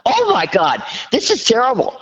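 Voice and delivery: high-pitched, laughing exclamation of dismay; retold hilarity. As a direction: relive a helpless fit of laughter, voice shooting up. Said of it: "We both laughed so hard".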